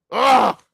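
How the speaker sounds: loud, high frustrated ugh; a real groan of annoyance; disgusted groan. Real, not acted. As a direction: let out a sharp groan of disgust. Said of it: "I'm pissed".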